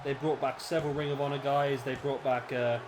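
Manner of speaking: usual speaking voice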